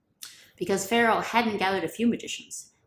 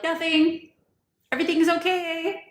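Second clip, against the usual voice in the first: much higher, loud, strained reassurance; nervous cover-up; 10 semitones higher, 6 dB louder than usual. Acted. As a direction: squeak out a guilty all-clear. Magicians covering up to Pharaoh.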